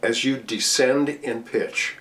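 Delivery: ordinary delivery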